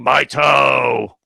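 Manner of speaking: imitated drawn-out scream; Rupert. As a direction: cry it out long, mimicking someone in pain. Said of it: "was screaming".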